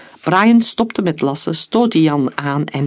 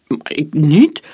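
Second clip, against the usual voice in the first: stammering; 5 dB louder, slower than usual. A narrator's reading. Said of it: "stamelde Scott".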